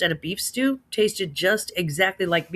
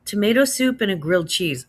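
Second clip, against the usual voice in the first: ordinary delivery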